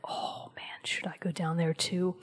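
a hushed, hesitant inner-voice whisper; whispered thought. Retold or imitated. Voicing the player's doubt before the fall, a whispered thought. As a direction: whisper it to yourself at the edge of the abyss.

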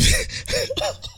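soft, squeaky burst of laughter; high wheezing laugh. Real, not acted. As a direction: laugh yourself breathless. Cracking up, says I'm gone.

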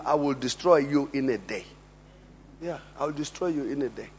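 low, hushed menace; quiet threat. Retold or imitated. Soccer boss warning a young star who is leaving, quiet threat. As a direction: threaten softly, keeping the voice down.

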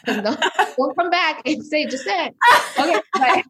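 high, loud, laughing protest; half-laughing mock warning. Real, not acted. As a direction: joke it off while still laughing.

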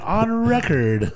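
lightly voiced old man, slow with a laugh. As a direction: drawl it like a creaky old-timer. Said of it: "That sounded like a very old man".

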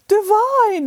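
high loud rapture; delighted exclamation. Acted. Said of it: "exclaimed the fairy godmother".

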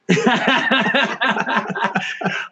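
long, high run of laughter; real laugh. Real, not acted. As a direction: crack up at your own folly. Laughs at his own realization.

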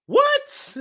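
high, loud stunned "what"; squeaked disbelieving what; shocked what. Retold or imitated. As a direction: react in total disbelief. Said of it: "We were like".

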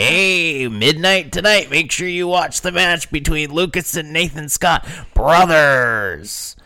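high-pitched, hyped DJ promo voice; Doc Hendricks. Acted. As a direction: hype the match like a wrestling promo announcer. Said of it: "his D J voice".

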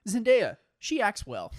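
hammed-up promotional blurb; mock box-blurb voice. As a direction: proclaim it like a silly cover quote.

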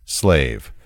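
ordinary talk

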